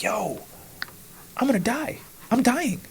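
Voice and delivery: high, strained cry of exhaustion; mock panic. Exhausted climber telling himself he'll die, high mock panic. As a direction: pitch it up like you're at your limit. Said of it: "And I'm like".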